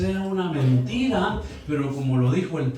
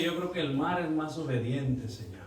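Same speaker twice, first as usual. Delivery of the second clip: hushed aside; 4 semitones lower, 8 dB quieter than usual. Retold or imitated. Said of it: "Y ahí sí yo dije".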